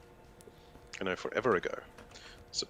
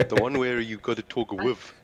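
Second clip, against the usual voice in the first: put-on, loud South African accent, pitched up; broad South African accent; 7 dB louder than usual. Shown as a demonstration. Teasing friends about South African accents, hammed-up and loud. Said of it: "It depends on which South African accent".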